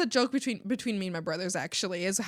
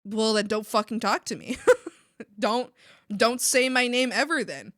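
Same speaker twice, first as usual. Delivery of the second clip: ordinary talk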